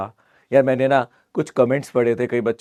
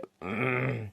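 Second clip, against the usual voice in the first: rough clearing of the throat; teacher with a bad throat clears it before going on; 8 dB quieter than usual. Real, not acted. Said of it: "गला बिल्कुल गया".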